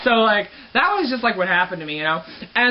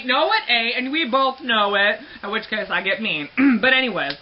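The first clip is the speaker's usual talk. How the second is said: usual speaking voice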